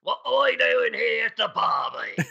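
shouted mock Australian greeting; playful Aussie shout across the yard; Australian accent. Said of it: "with an Australian accent".

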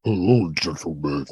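deep, slow, seductive greeting; sexy voice impression. Guest greeting the podcast hosts, in a deep sexy voice. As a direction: put on an impossibly sexy low voice.

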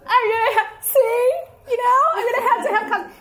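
high, hammed-up sobbing; put-on tearful wail; mock crying. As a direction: blubber through the words, comically overdone. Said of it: "he sees me crying".

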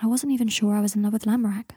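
plain reading voice

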